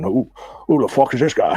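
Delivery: Louisiana coach voice; put-on Louisiana accent, slurred together; Coach Ed Ogeron impression. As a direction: imitate a Louisiana football coach. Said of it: "that voice, that Louisiana voice".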